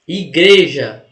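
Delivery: "Igreja" drawn out slowly, loud on GRE; teacher showing the strong syllable in "Igreja"; stressed syllable demo. Shown as a demonstration. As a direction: lean hard and long on the GRE of "Igreja". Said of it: "A sílaba mais forte".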